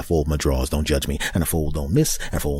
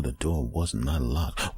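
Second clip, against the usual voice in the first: slow, soft ominous line; hushed dread; 3 semitones lower, 7 dB quieter, much slower than usual. Retold or imitated. Realizing the door was unlocked, slow hush.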